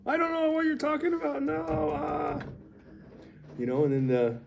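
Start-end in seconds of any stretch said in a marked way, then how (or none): 0.1-2.4 very high, panicky mock denial; hammed-up falsetto panic; freaking out. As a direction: squeal the denial like someone caught out. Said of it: "And he freaks out".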